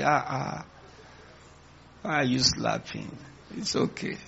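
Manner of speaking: soft, meek protest fading breathy; hushed, submissive mock reply; acted humility. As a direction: play someone too humbled to fight back. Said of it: "you will be at the peak of humility".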